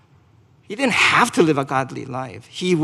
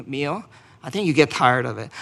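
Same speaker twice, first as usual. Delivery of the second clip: ordinary talk, nothing marked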